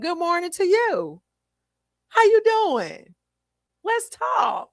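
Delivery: high, drawn-out, hammed-up morning cheer; overeager greeting. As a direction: pester a sleepy person with high-pitched pep. Acts out her overeager morning greeting.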